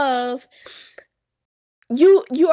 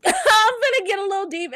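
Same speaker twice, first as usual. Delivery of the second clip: tearful laugh, voice cracking; laughing through tears; 7 semitones higher than usual. Real, not acted. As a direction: laugh to hold back crying. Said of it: "I ain't never cried on this podcast".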